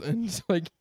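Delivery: ordinary delivery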